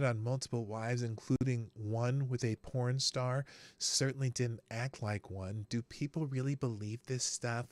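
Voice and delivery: low soft mock pundit read; Krasenstein voice. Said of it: "I gotta do it in the Krasenstein voice".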